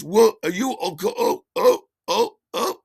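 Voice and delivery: high, loud, stammering dismay; imitated yelps of a startled drunk; flustered friend. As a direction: sputter helplessly at the accident. Friend reacts to the crash.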